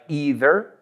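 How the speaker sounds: either said EE-ther; "either" starting like "eat"; long E pronunciation. Shown as a demonstration. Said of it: "several accepted pronunciations".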